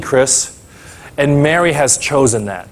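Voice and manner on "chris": usual speaking voice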